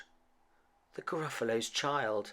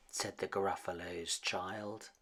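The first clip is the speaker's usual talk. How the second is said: plain reading voice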